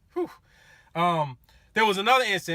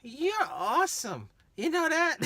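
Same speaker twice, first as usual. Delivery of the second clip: high, slow put-on tipsy woman; drunk woman voice; 9 semitones higher than usual. Drunk passenger thanking her driver, high slurry voice. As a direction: play the tipsy lady praising him. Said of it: "and she says".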